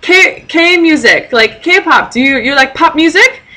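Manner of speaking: high, slow, halting broken English; eager old man. Retold or imitated. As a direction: question her eagerly like a curious old man. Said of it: "he goes".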